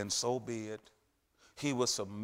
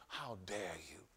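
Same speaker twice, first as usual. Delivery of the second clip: low, much softer sly taunt; devil's taunt; 5 semitones lower, 9 dB quieter than usual. Acted. Preacher voicing the devil to the gifted, low and soft. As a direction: murmur it like the devil. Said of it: "the devil is saying".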